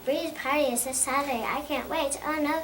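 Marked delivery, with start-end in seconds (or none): 2.2-2.6 startled, louder and higher; cry of dismay. Acted. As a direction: gasp it out like a mom who just remembered. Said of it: "cries my mom".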